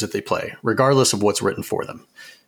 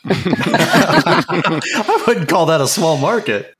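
laughing; 6 semitones higher, much slower than usual. Real, not acted. Laughing joke about market size.

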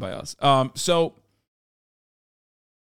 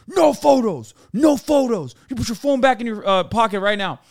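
high loud shout of no photos; shouted order; 7 semitones higher, 4 dB louder than usual. Retold or imitated. Officer catching the traveler taking a photo, high loud shout. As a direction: yell the ban at him. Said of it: "blows a gasket".

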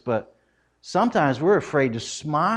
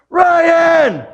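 high loud shout up the stairs; calling out; 9 dB louder than usual. Retold or imitated. Wife shouting her son's name to wake him. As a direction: yell a name to wake someone.

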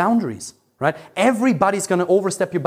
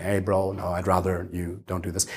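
low, soft and unbothered; very calm; 8 semitones lower, 7 dB quieter than usual. Retold or imitated. Imitating Joe Rogan calmly telling someone to stop. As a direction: calmly set a boundary without heat. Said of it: "When he's very calm, he's like".

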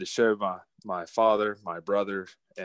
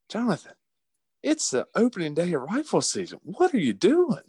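much higher, exasperated big-brother scold; mock the brother telling him off, pitched way up; imitating older brother; 10 semitones higher than usual. Brother scolding over walkie-talkie.

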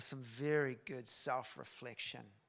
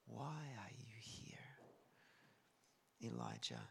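whispered, low question; hushed, intimate whisper; still small voice; 3 semitones lower, 14 dB quieter than usual. Acted. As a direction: breathe it out in a whisper, then pause before the name. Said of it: "It's a still small voice".

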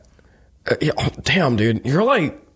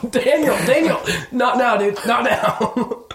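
laughing scold; 9 semitones higher, slower than usual. Real, not acted. Scolding the cohost mid-bit.

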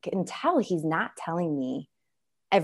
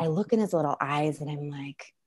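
ordinary talk

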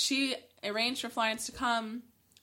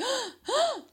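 shocked gasp. Retold or imitated. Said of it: "you're like".

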